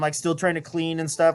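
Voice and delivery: usual speaking voice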